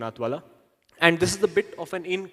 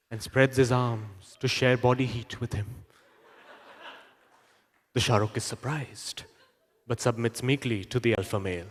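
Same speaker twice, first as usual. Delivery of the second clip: wildlife documentary narrator; 5 semitones lower than usual. Acted. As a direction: narrate like a solemn wildlife film. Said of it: "National Geographic voiceover guy describing".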